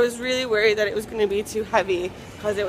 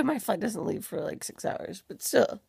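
ordinary delivery

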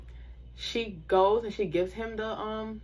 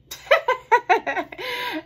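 bursting into a high, loud laugh; 9 dB louder than usual. Real, not acted. Vlogger laughing at her crush's pickup line, loud and high. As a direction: let a squealing laugh out. Laughing recalling his words.

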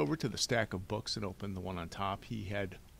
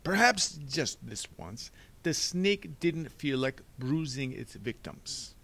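slow, higher, deliberate doctor; evasive Doctor Lucafont; 5 semitones higher, 6 dB louder, slower than usual. Acted. Doctor excusing the snake evidence, slow and pompous. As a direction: draw out each word with pomp.

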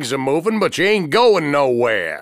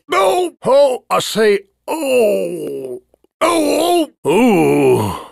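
pained cries; 4 semitones higher, much slower than usual. Acted. Hurt and defeated cries.

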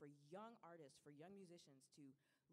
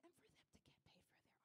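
whispered plea; 11 semitones higher, 16 dB quieter, faster than usual. Real, not acted. Pleading for artists' pay.

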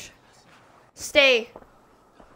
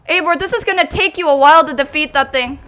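ordinary delivery

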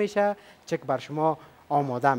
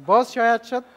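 higher and louder, repeating for her; 5 semitones higher, 5 dB louder than usual. Shown as a demonstration. Host repeating the question louder to the shy contestant. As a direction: call it again so she hears. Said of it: "بلند بگو".

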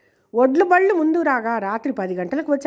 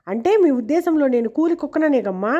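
ordinary delivery, nothing marked

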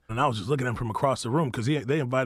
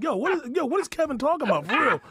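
much higher, puzzled question; confused asking; 14 semitones higher than usual. Retold or imitated. Guest retelling how he asked Kevin's crew what Kevin meant. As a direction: ask his crew in bewilderment. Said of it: "I'm like".

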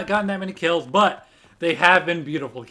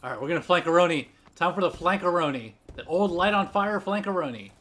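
ordinary talk

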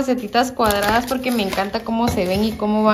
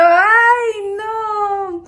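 shocked cry; 10 semitones higher than usual. Retold or imitated. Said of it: "me dieron un susto".

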